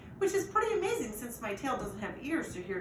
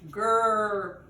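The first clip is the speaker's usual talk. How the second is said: loud grumbled grrr; grumbling growl; 9 dB louder than usual. A narrator's reading. Owner fed up with the mess, loud grumbling growl. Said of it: "Josh grumbles".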